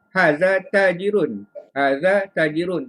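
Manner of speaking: "Haza tajirun." said slowly as a flat statement, falling at the end; statement tune. Shown as a demonstration. Teacher shows students how a statement sounds. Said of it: "That is a statement".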